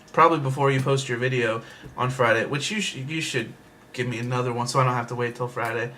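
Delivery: ordinary talk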